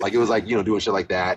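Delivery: ordinary delivery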